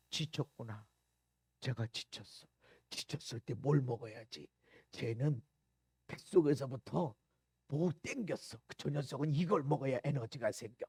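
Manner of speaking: low, soft musing; mother's inner thought. Acted. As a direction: think aloud quietly as a knowing mother. Mother quietly reading her child's call. (낮고 조용한 엄마의 혼잣말)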